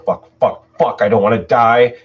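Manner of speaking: loud, rising terrified swearing; panicked cursing. Acted. Trapped father shouting in terror at the door. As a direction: panic as they force the door. Man barricading door from ghosts.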